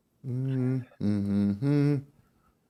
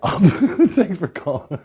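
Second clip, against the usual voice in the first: laughing; 7 semitones higher, much slower than usual. Real, not acted. Blows the prank, laughs.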